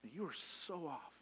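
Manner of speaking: quiet rebuke. Retold or imitated. Jesus to Peter, correcting him, soft and slow. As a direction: let him down gently but firmly. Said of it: "He says".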